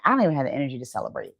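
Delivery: trailing off, low and spent, dropping to almost nothing; drained and exhausted. Retold or imitated. Burned-out woman voicing her own exhaustion, drained and fading. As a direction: sound like you have nothing left to give. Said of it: "you're just like".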